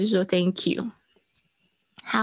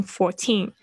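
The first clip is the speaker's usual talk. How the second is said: "fourteen" with weight on the final syllable; stressed TEEN. Shown as a demonstration. Teacher pointing out the accent, end-stressed "fourteen". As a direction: hit "teen" in "fourteen" with a crisp t. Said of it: "就是有重音".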